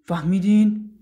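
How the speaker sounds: loud, sharp yell; forceful one-word shout; shouted demand. A narrator's reading. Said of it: "داد زد".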